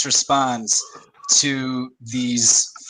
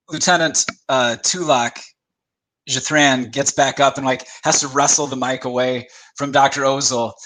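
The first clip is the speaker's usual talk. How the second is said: ordinary talk, nothing marked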